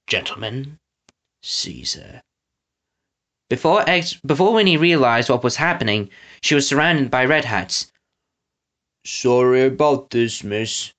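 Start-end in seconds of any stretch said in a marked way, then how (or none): 0.1-2.2 soft, low, weary order. Acted. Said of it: "Snatcher sighed wearily". 9.1-10.9 slow, grumbled apology. Acted. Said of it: "Trout grumbled".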